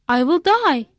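ordinary delivery, nothing marked

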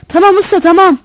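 loud, high shout up to the boss. A narrator's reading. Assistant rouses the dozing driver, merciless loud shout. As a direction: shout it out insistently. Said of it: "diye bağırdı".

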